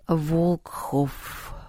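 soft, musing Волкхоф, said to himself; pensive repetition. Acted. Антоха repeats the strange name, soft and musing. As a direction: murmur Волкхоф quietly, lost in thought. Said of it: "в задумчивости повторил Антоха".

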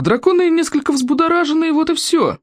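high-pitched indignant protest; blustering outrage at normal volume. A narrator's reading. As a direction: dismiss it huffily, pitch held high. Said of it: "возмутился Стоик".

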